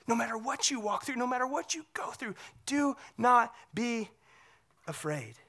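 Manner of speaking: gentle hushed reassurance. Acted. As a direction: lean in and murmur the comfort. Continues hushed quote of Jesus.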